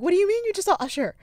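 fast, high, loud incredulous question; shocked disbelief. Retold or imitated. Friend reacting to a celebrity sighting, loudly incredulous. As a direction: blurt it out in retold astonishment.

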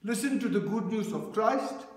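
ordinary delivery

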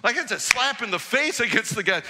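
ordinary delivery

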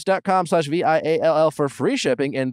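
polished promo announcer delivery; podcast ad read. Acted. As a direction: sell it clearly, every web address letter distinct. Reads sponsor ads.

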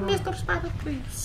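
put-on high-pitched Eastern European kid accent; little Eastern European child. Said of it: "little Eastern European child".

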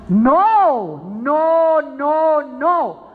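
loud, ringing no, no, no; preacher shouting a firm refusal; emphatic denial. Real, not acted. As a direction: cut the lie off hard. Preacher rejects the lie.

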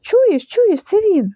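high, voiced, frightened hush; scared stage whisper. A narrator's reading. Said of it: "наполохано прошепотів паць". (наполоханий шепіт)

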